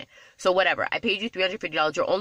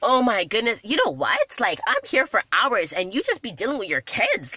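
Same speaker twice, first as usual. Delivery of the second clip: mock complaining guest; 5 semitones higher than usual. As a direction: ham up a whiny guest griping at her host. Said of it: "never once did I say".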